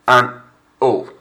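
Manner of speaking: an O with the H dropped; "an O", the H never sounded; silent H. Shown as a demonstration. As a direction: model "an O" loud for the class. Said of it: "Notice that the H is silent".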